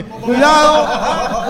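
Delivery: slow, high eerie drawn-out warning; slow creepy warning; spooky warning. Acted. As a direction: warn like a ghost story teller. Spooky warning about nightmares.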